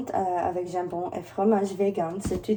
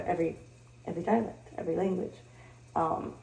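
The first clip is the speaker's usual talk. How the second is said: ordinary delivery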